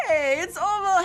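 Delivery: high, bright hello to a raider; high-pitched happy hello to raider Ovo; excited greeting. Real, not acted. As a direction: squeal hello to a friend arriving.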